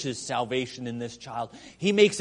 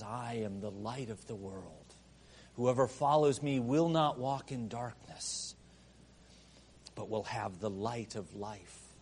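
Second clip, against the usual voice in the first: softer, lower intimate reading; quiet reverent quoting; hushed scripture; 7 semitones lower, 9 dB quieter than usual. Acted. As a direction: say it gently, like a confession. Quotes Jesus softly.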